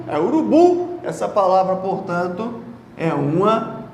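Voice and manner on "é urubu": "urubu" with BU high and strong; stress on last syllable. Shown as a demonstration. Teacher confirming the strong syllable, "urubu" stressed on BU. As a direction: land hard on the BU of "urubu". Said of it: "O forte é o bu".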